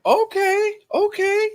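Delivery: suddenly realizing something; high surprised okay, okay. Real, not acted. News that he's from Puerto Rico.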